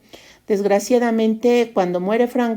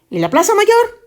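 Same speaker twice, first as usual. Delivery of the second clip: blunt Spaniard question; 11 semitones higher than usual. Acted. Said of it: "Cuando es más fácil decir".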